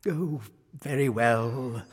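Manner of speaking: slow, resigned king; reluctant surrender, drawn out; sighing. A narrator's reading. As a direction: give in with a weary sigh. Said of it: "sighed the king".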